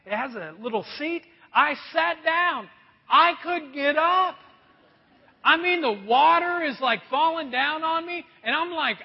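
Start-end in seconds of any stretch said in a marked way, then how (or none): none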